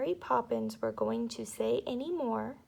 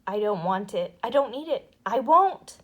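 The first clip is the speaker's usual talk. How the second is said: growing louder refusal ending loud; protesting child; 10 dB louder than usual. A narrator's reading. Michael refusing the medicine, building louder. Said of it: "He began to protest".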